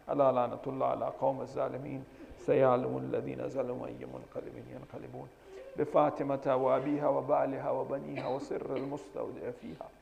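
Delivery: تلاوة سريعة; rapid recitation. Acted. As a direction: rattle off the formula.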